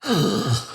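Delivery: sharp shocked intake of breath; retold gasp. Hearing from his mother that his father was shot, a gasp. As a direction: gasp as if hearing the news again. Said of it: "And I said".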